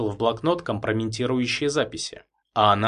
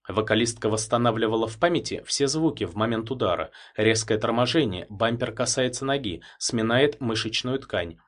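ordinary delivery

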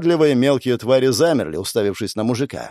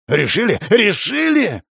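incredulous yell, leaping much higher; near screech; 9 semitones higher than usual. A narrator's reading. Enraged man confronting the sister, almost screeching. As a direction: throw it back, rising to a shriek. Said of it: "Он едва ли не визжал".

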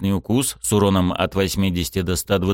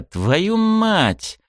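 startled curse; 12 semitones higher than usual. Acted. Sudden curse at the attack.

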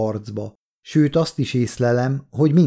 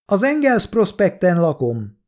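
higher-pitched call, moderate volume; 7 semitones higher than usual. A narrator's reading. Kolja tells his address to men outside, calling out. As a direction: keep calling toward the window. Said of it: "kiáltotta az ablak felé".

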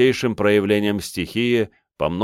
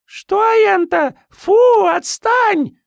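put-on very high, loud squeaky baby-monster voice; squeaky disgusted squeal; 7 dB louder, much slower than usual. Freak baby swats at clone. (писклявый визг с отвращением)

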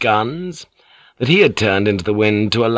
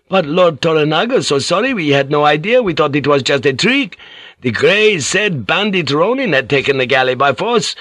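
higher, shouted mock surprise; feigned astonishment; 6 semitones higher than usual. Priest shouting back to the lord, feigning astonishment. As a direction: shout back pretending to be amazed. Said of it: "shouted back in Japanese, feigning astonishment".

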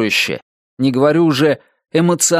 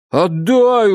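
high, drawn-out mock plea; abject pleading; 6 semitones higher than usual. A narrator's reading. Narrator mocking a bad dialogue tag, abjectly pleading. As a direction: beg with hammed-up humiliation. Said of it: "униженно взмолился он".

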